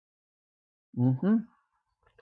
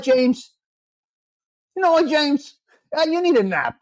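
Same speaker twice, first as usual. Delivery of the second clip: mock exasperation; 5 semitones higher than usual. Scolding chat viewer James.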